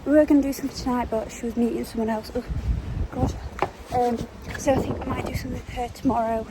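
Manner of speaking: gasping between phrases while walking; breathless, catching breath mid-sentence. Real, not acted. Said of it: "I'm out of breath".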